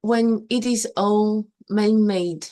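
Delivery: usual speaking voice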